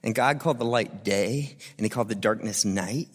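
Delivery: solemn scripture reading. Acted. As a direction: read it low and reverent, like a poem. Reads Genesis one as poem.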